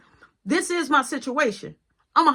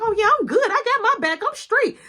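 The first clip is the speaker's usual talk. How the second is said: put-on girlfriend's high, loud brush-off; high defiant sass; 11 semitones higher, 5 dB louder than usual. Girlfriend brushing him off, high defiant sass. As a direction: toss it off high and proud.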